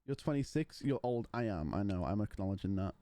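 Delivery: ordinary talk, nothing marked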